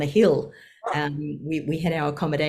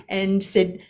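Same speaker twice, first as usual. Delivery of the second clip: normal talking voice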